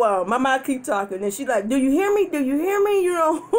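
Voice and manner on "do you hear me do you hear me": higher, insistent demand to be heard; pitched-up mock nagging; insistent mom. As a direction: imitate an exasperated mom repeating herself. Mom nagging granny in story.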